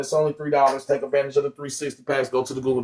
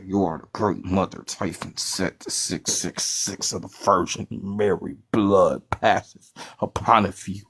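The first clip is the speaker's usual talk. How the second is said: spooky oracle voice; 6 semitones lower than usual. Pretend. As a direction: intone it like an ominous seer. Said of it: "why are you talking like that".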